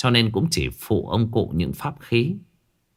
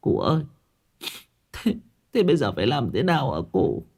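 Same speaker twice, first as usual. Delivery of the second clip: higher, whimpering plea to an elder; tearful pleading; 4 semitones higher, 4 dB quieter, faster than usual. Acted. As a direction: plead as if about to cry. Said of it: "Chị vợ mếu máo". (mếu máo van hỏi)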